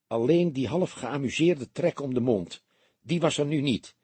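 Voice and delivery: plain reading voice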